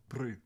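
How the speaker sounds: "Bru" said with a short rounded oo, not bro; South African bru. Shown as a demonstration. Teaching chat the South African slang "Bru" with an oo vowel. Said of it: "Not bro".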